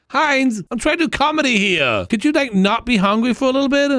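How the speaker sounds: high, whiny comedian's complaint; mock exasperation. Comedian pleading with hungry Heinz to wait, mock exasperation. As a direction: complain in a high, put-upon voice.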